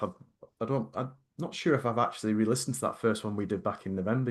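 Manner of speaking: ordinary talk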